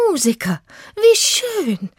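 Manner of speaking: very high, gushing exclamation; delighted. Acted. Old lady meeting fellow musicians, delighted. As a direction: rise to a thrilled coo.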